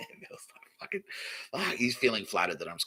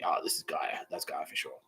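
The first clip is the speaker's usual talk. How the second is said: whispered awe; 5 semitones lower, 7 dB quieter than usual. Retold or imitated. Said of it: "unvoiced whisper".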